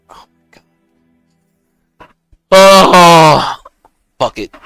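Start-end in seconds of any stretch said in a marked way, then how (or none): 0.1-2.1 breathless whispered gasp. Real, not acted. Unvoiced exclamation. 2.5-3.6 loud startled oh; sudden surprised outburst. Real, not acted.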